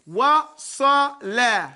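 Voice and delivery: "wa sa la" drawn out syllable by syllable, slow. Shown as a demonstration. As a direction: spell out "wa sa la" very slowly. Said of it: "For example".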